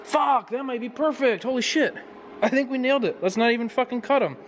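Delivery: real delight at a perfect fit; excited. Real, not acted. Springs turn out a perfect fit.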